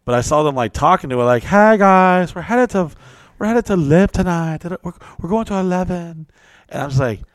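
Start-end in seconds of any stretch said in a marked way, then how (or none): 1.4-6.3 lightly raised, bubbly imitation of the models; mock girly party voice. To him, models announcing the club, mock bubbly. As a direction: mimic the excited party girls, a bit higher.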